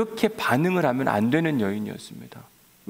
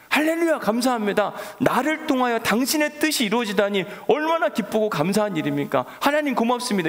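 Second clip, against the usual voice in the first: bright, much higher, eager praise; joyful thanks; 9 semitones higher than usual. Pretend. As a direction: burst out in glad thanks to God. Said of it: "이렇게 해야 되는데". (높은 목소리로 기뻐하며 감사하는 기도)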